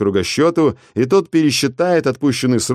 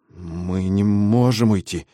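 stammering; slower than usual. Acted. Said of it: "запинаясь, пролепетал он".

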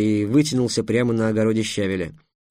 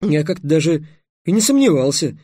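higher, louder resigned complaint; 5 semitones higher, 5 dB louder than usual. Acted. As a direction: say it as doomed to this all along. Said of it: "обречённо сказал". (обречённо, повышенным голосом)

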